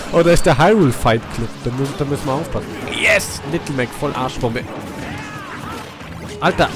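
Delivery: ordinary talk, nothing marked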